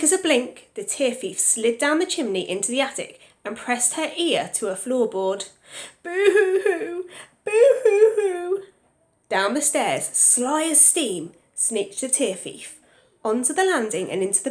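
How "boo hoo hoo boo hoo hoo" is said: high, slow, louder boo-hoo sobs; child crying. Acted. Boy in the bath wailing boo-hoo. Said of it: "crying his eyes out".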